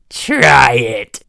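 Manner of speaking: ordinary delivery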